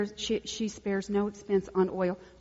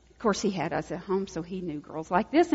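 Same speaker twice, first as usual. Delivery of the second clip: ordinary delivery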